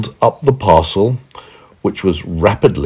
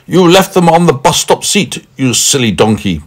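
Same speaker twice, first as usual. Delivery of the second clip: raised, exasperated scolding; impatient; 8 semitones higher, 3 dB louder than usual. A narrator's reading. Man telling Meddle off, impatient. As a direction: chide a fool impatiently, pitched up. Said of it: "said the man impatiently".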